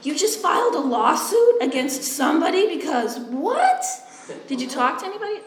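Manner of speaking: high, laughing mock grilling; mocking interrogation. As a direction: needle her with incredulous questions. Mock-quizzing the client.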